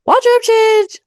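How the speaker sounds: high, loud call-out; announcing. Real, not acted. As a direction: sing it out loud and high, stretched. Calls out a wardrobe change.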